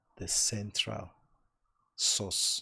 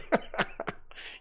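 breathy laugh. Real, not acted. Laughing at mommy's comment.

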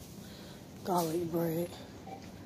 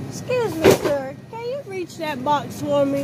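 polite high voice; 5 semitones higher, 6 dB louder than usual. Acted. Asking a stranger for help.

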